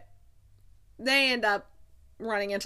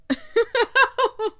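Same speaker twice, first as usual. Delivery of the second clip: high, loud burst of laughter; real delighted laugh, pitched way up; giddy laugh; 14 semitones higher, 9 dB louder than usual. Real, not acted. Reacting giddily to the passage.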